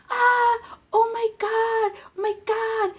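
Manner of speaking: high-pitched imitation of shrieking mother, easing down; mock screaming. Daughter mimics her mother's reaction to being mooned, mock screaming. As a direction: imitate an appalled mother's shriek, without full volume. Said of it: "she starts fucking screaming".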